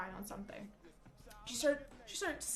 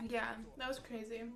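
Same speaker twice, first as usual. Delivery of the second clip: normal talking voice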